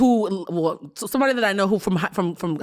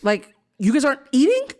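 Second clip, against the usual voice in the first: high, incredulous excuse me; shocked disbelief; 7 semitones higher than usual. Retold or imitated. Daughter hearing her cousins have no food, shocked. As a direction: voice jumps way up in disbelief. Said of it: "And I was like".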